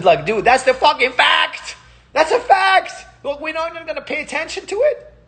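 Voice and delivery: high, half-laughing disbelief; excited laughing outburst. Real, not acted. Ranting to his viewers about ignored facts, excited and laughing.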